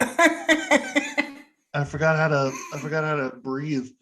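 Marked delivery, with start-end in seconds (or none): 0.0-2.5 laughing through the words after choking; breathless laugh while talking. Real, not acted. Coughing fit, laughing.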